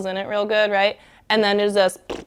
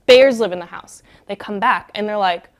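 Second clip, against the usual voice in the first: plain reading voice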